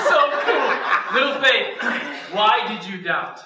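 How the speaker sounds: amused, bubbling over with laughter; laughing. Real, not acted. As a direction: giggle while delivering the line. Laughs while quoting Jesus.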